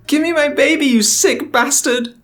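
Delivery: yelling. Acted. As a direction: scream it with all you have. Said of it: "He yelled at the top of his lungs".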